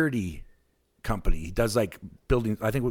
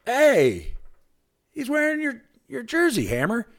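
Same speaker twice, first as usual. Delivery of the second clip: much higher, eager pointing-out voice; excited buddy; 14 semitones higher, 3 dB louder than usual. Retold or imitated. Hammer's buddy pointing out the fan's jersey, high and eager. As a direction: squeal it like an eager sidekick. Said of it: "and he's like".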